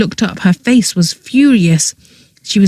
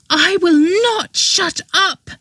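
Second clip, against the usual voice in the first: higher, defiant shout; higher girl voice defying her brother; 12 semitones higher, 4 dB quieter than usual. A narrator's reading. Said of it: "she shouted".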